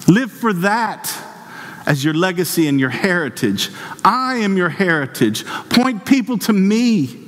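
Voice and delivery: high-pitched, insistent declamation; impassioned preaching. Acted. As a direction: speak as God in a high, fervent pulpit voice. Preaches in God's voice.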